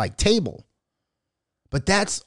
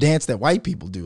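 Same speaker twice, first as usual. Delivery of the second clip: ordinary delivery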